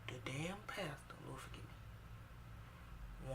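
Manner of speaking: whispered, slowed-down confession; slow whisper, like saying it in church; hushed aside. Real, not acted. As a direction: drop to a guilty whisper mid-story. Whispered aside about the pastor.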